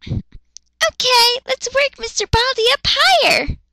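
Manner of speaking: put-on high, quick chirpy kid voice; high excitable child; little girl voice. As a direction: chatter like a hyper little girl. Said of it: "Yachiru voice".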